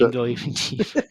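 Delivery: breaking into laughter while talking; amused, laughing through the repeat. Real, not acted. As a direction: crack up on the words, voice dissolving into giggles. Cracks up repeating friend's jab.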